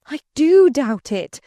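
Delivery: high, loud, slow retort; fresh energetic outburst. A narrator's reading. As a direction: break out emphatically, leaning on each word. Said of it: "breaking out with fresh energy".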